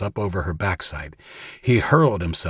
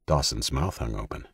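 ordinary delivery, nothing marked

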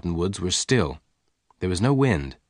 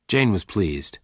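ordinary delivery, nothing marked

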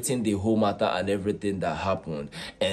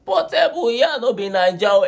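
much higher, louder cheering call; excited fans; 12 semitones higher, 7 dB louder than usual. Retold or imitated. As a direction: cheer him on like a crowd of fans. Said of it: "all the fans were like".

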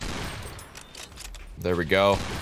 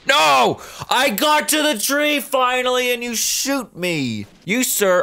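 outraged shout; 11 semitones higher, slower than usual. Real, not acted. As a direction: howl at the unfairness. Shot at his tree.

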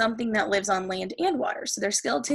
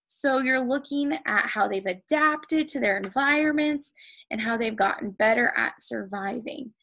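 usual speaking voice

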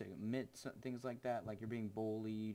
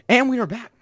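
high, booming comeback announcement; loud show-host return; 13 semitones higher, 24 dB louder, faster than usual. Pretend. Streamer welcoming viewers back, loud excited announcer.

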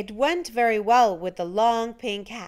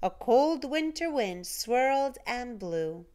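ordinary delivery

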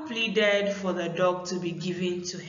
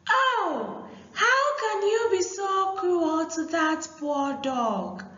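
put-on high, loud pleading cry to the boys; crying out loudly; 10 semitones higher than usual. Aku cries to the boys to spare the dog, high and pleading. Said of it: "crying loudly".

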